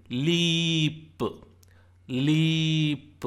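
"leap" with a long held ee, then released p; demonstrated long vowel. Shown as a demonstration. Teacher shows lee as in leap, stretched "leeeap". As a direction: draw "leap" out as leee-p. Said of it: "it is pronounced like L E A in".

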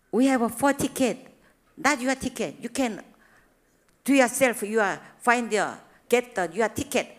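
loud, bossy instruction to friends; firm command. Retold or imitated. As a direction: tell them firmly to get their own. Telling friends firmly.

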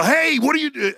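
high, loud alarmed shout; yelling. Retold or imitated. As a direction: yell out a sudden warning. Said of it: "So I yell".

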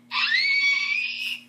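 very high, loud, held squeal; high shriek. Acted. Missy face first into concrete, high shriek. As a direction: let out one long high squeal. Acted scream in story.

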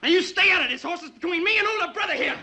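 high, loud angry warning; young brother yelling in a fight; angry shout. Acted. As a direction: snap at him to stay out of it. Brothers quarrelling, told to stop.

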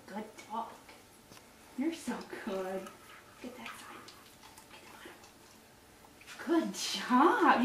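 near-whispered coaxing to a pet; soft soothing praise. Real, not acted. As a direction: keep the dog calm with hushed praise. Soothing dog while brushing teeth.

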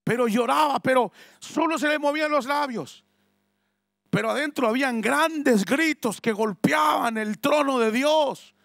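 loud, high fervent preaching; preaching at full force. Acted. Preacher stirs the congregation, fervent shout. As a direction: build the fire as you preach it. Preacher retelling Ana's silent cry.

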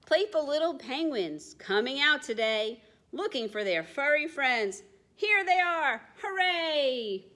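put-on bouncy sing-song for children; rhyming read-aloud. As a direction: bounce the rhyme along. Reading rhyming book aloud.

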